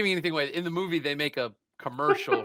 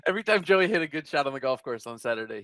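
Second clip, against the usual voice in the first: ordinary delivery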